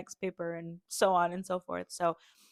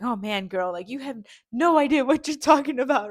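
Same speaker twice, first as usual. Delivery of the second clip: a higher, louder scoffing church lady; mock judging older woman; 6 semitones higher, 9 dB louder than usual. Imagining the older women scoffing at her, mock disbelief. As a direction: tease yourself in their disbelieving voice.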